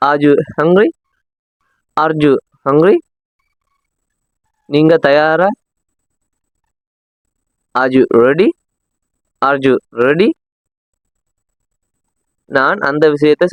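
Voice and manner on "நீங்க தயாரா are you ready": usual speaking voice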